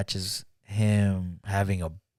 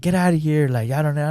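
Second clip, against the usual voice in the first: retold yell; 6 semitones higher than usual. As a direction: shoo the kids off, half-yelling. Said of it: "yelling at them".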